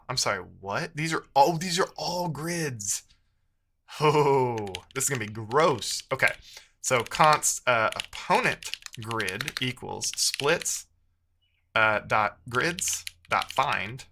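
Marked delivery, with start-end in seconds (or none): none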